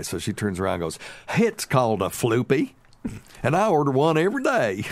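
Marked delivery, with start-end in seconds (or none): 1.4-4.8 mock know-it-all mother-in-law, rising higher and louder; hammed-up lecture, pitched up; schooling someone. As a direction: imitate her proudly setting a stranger straight. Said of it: "so she's go school this guy".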